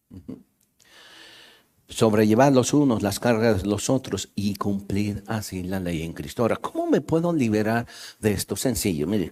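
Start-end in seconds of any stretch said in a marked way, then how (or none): 1.9-6.4 hushed scripture reading. Acted. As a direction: let the warning sink in quietly.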